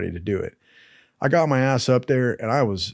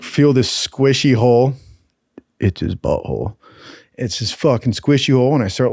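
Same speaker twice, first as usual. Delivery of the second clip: normal talking voice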